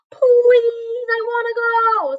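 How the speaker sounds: put-on high, eager little girl; pleading girl voice. A narrator's reading. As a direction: beg to come along, bright and high. Annie begging to come.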